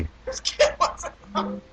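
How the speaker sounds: words squeezed out through laughter; laughing. Real, not acted. Co-host cracking up at the Ken Watanabe casting, laughing.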